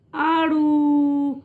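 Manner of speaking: "آڑو" with a long stretched آ; long drawn آ in "آڑو", teacher demo. Shown as a demonstration. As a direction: hold the آ of "آڑو" slowly. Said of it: "اس کی آواز تھوڑی کھچ کے آتی ہے".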